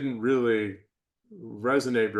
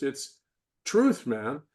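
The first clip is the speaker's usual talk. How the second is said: ordinary talk, nothing marked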